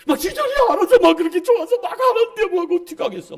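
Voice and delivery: high, loud gleeful what-can-I-do; excited mock exasperation. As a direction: burst out as if helpless before their zeal. Acting out eager members.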